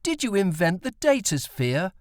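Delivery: put-on high, eager young boy; light boy's voice, asking. A narrator's reading. Tim asks his dad at supper, high and eager.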